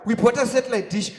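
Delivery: ordinary talk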